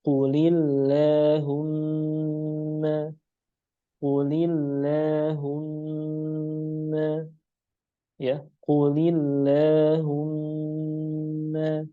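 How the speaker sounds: Qulillahumma drawn out slowly, lam thin; slow model recitation. Shown as a demonstration. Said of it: "Lafzul jalalah tipis".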